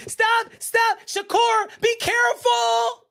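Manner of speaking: very high, squealing panic; hammed-up fretting spectator in a high squeal; mock panicked fan. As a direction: plead frantically in a falsetto.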